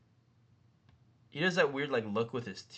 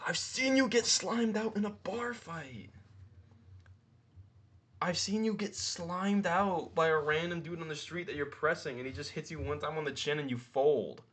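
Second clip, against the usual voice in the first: mocking retort; 7 semitones higher than usual. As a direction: needle him with a sneering high voice. Imagined retort to BJ Penn.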